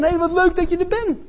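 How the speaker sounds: high, cheerful welcome to a neighbour; friendly greeting. Shown as a demonstration. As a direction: greet them like an old friend.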